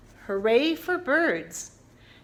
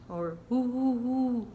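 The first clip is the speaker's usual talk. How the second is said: owl hoot; 3 dB quieter than usual. Shown as a demonstration. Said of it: "like an owl".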